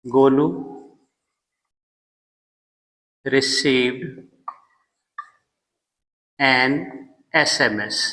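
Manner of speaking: long pauses between "Golu", "received", "an", "SMS"; slow dictation for the class; dictated word by word. Shown as a demonstration. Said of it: "जैसे हम कह सकते हैं". (रुक-रुक कर लिखवाना)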